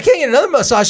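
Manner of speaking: mom's protest said loudly; emphatic retell. Retold or imitated. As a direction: punch it this time with mock outrage. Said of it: "But she's like".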